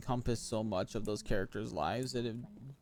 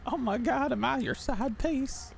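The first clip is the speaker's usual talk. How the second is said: pitched way up and slowed, teasing; high mock echo; 12 semitones higher than usual. Repeating her question back at her, high and teasing. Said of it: "repeating the partner's question".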